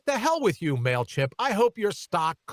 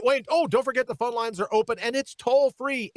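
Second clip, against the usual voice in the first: ordinary talk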